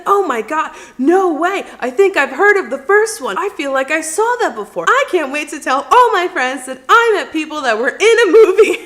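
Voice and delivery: high, loud starstruck gushing; imitating a thrilled stranger, high and loud; excited fan. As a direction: gush like he just met movie stars, laughing a little. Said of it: "this guy is so excited".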